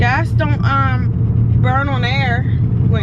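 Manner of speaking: loud, high and exasperated; heated argument. Real, not acted. Arguing over gas money.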